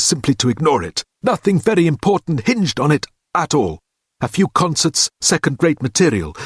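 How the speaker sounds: clipped academic drone explaining the Doris problem; Standish's voice. A narrator's reading. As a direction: hold forth like a pompous professor. Standish lecturing, held voice.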